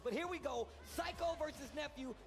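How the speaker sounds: building hype, loud and high; hype announcer. Real, not acted. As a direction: call the matchup like a ring announcer. Caster hypes the matchup intro.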